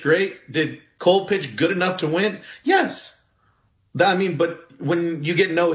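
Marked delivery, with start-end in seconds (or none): 2.6-3.2 high-pitched, emphatic "Yes"; exasperated high yes. Real, not acted. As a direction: answer your own question with a sharp jump up in pitch. Answers his own rhetorical question.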